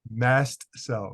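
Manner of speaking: Mast cell said slowly, both words clear; careful correction. Shown as a demonstration. Doctor correcting the host, saying Mast cell clearly. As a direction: spell out Mast cell as two distinct words. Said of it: "that's my South African accent".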